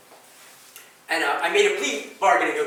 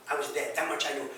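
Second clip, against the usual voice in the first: ordinary delivery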